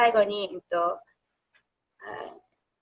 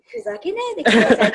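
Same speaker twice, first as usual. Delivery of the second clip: higher, louder exclamation with a laugh in it; mock indignant protest; 8 semitones higher, 7 dB louder, faster than usual. Viewer recalling her reaction to the film's ending, mock indignant. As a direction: blurt out a half-laughing protest.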